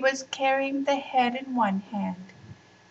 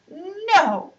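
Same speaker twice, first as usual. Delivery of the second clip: put-on high loud child's cry; little girl crying out at her brother; cried out; 10 semitones higher, 9 dB louder than usual. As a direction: cry out no, pulling away. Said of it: "cried Anissa".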